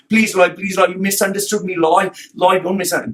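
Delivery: mock pleading. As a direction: beg like a pestering client. Retold pleading messages, mocked.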